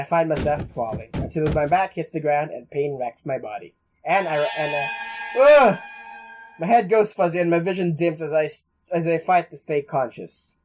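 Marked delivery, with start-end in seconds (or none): 5.4-5.8 high, loud grunt of impact; pained grunt. Acted. Narrator falls on the stairs, acted pained grunt. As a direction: cry out as if hitting the floor. Reading a painful fall aloud.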